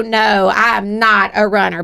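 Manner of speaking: ordinary delivery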